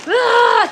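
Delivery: a high, loud frustrated "ah"; exasperated cry. Real, not acted. Streamer venting to chat about a bad morning, exasperated cry. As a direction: let out a sharp cry of frustration.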